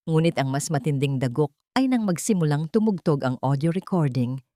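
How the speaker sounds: ordinary delivery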